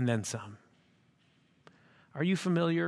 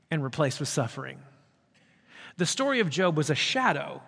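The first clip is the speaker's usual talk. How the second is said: usual speaking voice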